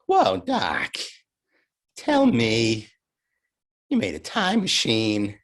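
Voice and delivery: lightly voiced Marty McFly, drawn out with long pauses; a fan's Marty impression, every phrase left hanging. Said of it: "And I do my thing".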